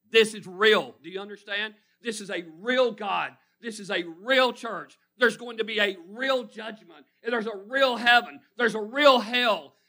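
loud, pressed, stress on "real" each time; passionate loud preaching. Real, not acted. Preacher insisting to the church that it is real, loud. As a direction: hammer "real" home. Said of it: "I'm passionate about what I'm saying".

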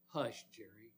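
low, soft calming voice; gentle hushing. Retold or imitated. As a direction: hush him softly and low. Jesus hushes a troubled believer.

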